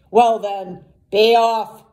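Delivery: loud, grudging troll. A narrator's reading. Troll letting the little goat go, troll voice. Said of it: "said the troll".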